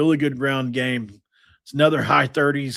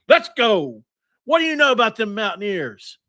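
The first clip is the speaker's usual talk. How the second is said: high, hyped rallying call; excited cheer; 9 semitones higher than usual. Real, not acted. Fan hyping the Mountaineers, excited high cheer. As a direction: fire up the fans.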